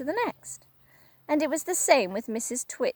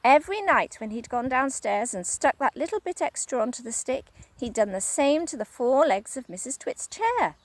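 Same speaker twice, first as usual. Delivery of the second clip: ordinary delivery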